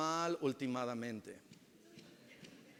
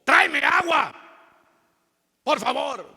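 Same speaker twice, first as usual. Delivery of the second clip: loud, much higher barking order; harsh demand; 8 semitones higher, 12 dB louder than usual. Shown as a demonstration. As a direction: snap the order at your spouse. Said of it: "es la manera que lo decimos". (orden brusca y gritada)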